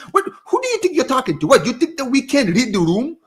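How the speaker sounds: high, indignant challenge to the media; mock-confront the journalists, incredulous; mock outraged comeback. Fan talking back to the media, mock outraged.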